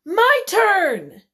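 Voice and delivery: put-on loud, high child's demand; grabbing sister's shout. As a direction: shout it out as you snatch the toy. Sister grabs the toy in story.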